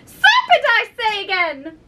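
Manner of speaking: bird's piercing cry, falling away; screeched accusation. A narrator's reading. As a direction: insist shrilly, then ease down. Pigeon repeats its screamed accusation.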